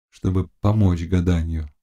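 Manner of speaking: usual speaking voice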